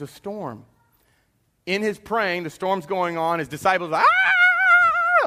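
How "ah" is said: long high-pitched mock scream; panicked scream. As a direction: scream like disciples freaking out in a storm. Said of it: "all freaking out".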